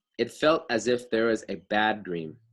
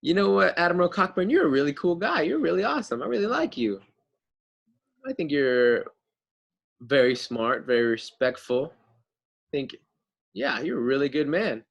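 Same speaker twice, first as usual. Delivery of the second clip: flattering; 3 semitones higher than usual. Retold or imitated. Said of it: "He's just saying".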